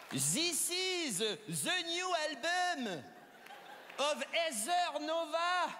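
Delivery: put-on slow, laboured English with a heavy French accent; a French person trying English. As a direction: sound out each English word in a thick French accent. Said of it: "pour parler anglais".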